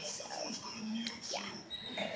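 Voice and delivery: whispered yeah under the breath. Real, not acted. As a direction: breathe the word out without voice. Trailing off after an excuse.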